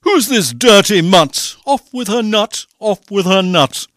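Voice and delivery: bellowed storybook outrage; crying out. A narrator's reading. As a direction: shout orders like a furious prince. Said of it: "The prince cried".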